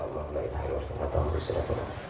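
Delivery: fast, whispered murmur of the verse; quiet recitation. Shown as a demonstration. Husband reads verse quietly while wife nags, whispering. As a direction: rattle the prayer off under the breath.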